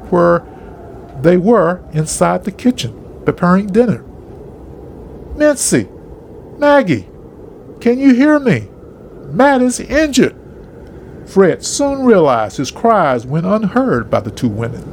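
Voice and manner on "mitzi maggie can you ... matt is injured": high-pitched calling out, not full force; yelled. A narrator's reading. Fred to women in the kitchen, yelled. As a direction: call for help, pitch well up. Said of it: "Fred yelled with his loudest voice".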